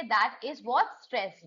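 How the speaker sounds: normal talking voice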